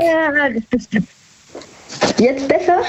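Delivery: hallend und weit weg; hallig am Telefon. Real, not acted. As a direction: Stimme hallt fern durchs Telefon. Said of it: "Ach, so hallig, so weit weg?". (hallige, entfernte Telefonstimme)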